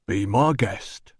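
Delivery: slow doorman voice; unbothered doorman. A narrator's reading. Doorman letting the visitor past, slow and unbothered. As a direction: wave him through, slowly.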